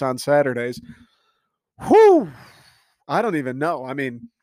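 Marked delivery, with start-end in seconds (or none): 1.9-2.3 high loud whoop of excitement; hyped-up whoop; excited whoop. Real, not acted. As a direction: let out a big whew. Hyped exclamation.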